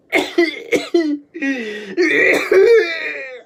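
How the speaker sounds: loud, desperate wheezing gasps; strained breaths breaking into coughs; laboured breathing. Shown as a demonstration. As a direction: heave for air with noisy wheezes. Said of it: "I could breathe like that".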